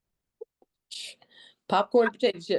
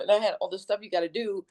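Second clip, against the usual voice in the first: usual speaking voice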